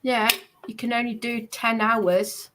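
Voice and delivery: ordinary talk, nothing marked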